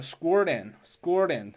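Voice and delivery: scored in said slowly, d running into in; linked scored in. Shown as a demonstration. Teacher slowly linking scored in. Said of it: "辅元连读".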